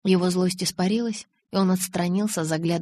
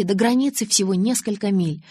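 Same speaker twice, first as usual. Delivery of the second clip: ordinary delivery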